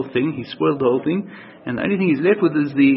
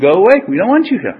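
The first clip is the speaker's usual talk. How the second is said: raised, higher-pitched shout of rejection; loud, insistent acted dismissal; 5 semitones higher, 7 dB louder than usual. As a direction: shout them away, upset. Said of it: "then they start shouting".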